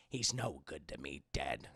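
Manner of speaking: very low, quiet bounty hunter; OG Boba Fett. Acted. Streamer proving his impression to chat, original Boba Fett voice. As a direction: drop it deep and hushed, slow menace. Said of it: "I did the O G Boba Fett voice".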